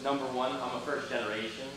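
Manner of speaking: ordinary talk, nothing marked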